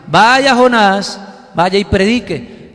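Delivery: God's slow command. Acted. God commanding Jonah.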